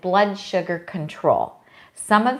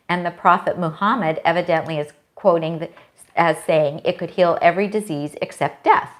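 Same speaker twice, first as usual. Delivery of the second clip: ordinary talk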